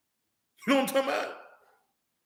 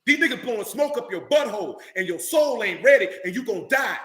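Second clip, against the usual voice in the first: usual speaking voice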